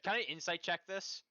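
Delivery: high, loud eager ask. Real, not acted. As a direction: push to roll it now. Suspects thief, demands roll.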